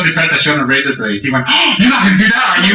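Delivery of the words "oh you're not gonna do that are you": high-pitched retold panic; mock alarm. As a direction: imitate a horrified student pleading. Acts out student alarmed by razor blade.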